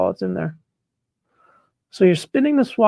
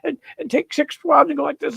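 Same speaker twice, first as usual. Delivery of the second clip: higher, slow, shouted mockery; mock careless swabber; 6 semitones higher, slower than usual. Shown as a demonstration. Showing viewers how not to swab, mock shouting. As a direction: ham up a brute jamming the swab in, shouting. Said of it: "You don't take your fruit and take your swab and go".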